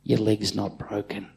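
soft, hushed comfort; whispered. Retold or imitated. Father soothing his son after the massage, whispering. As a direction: breathe it gently in his ear. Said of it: "I whispered in his ear".

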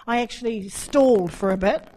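usual speaking voice

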